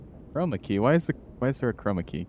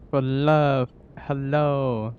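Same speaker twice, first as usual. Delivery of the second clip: put-on voice; 4 semitones higher, much slower than usual. Playful greeting voice.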